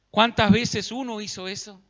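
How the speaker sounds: ordinary talk, nothing marked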